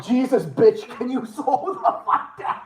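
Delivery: high, loud outburst breaking into laughter; hammed-up high-pitched complaint; mock exasperation. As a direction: imitate an exasperated plea, cracking up. Repeating an exasperated quote, amused.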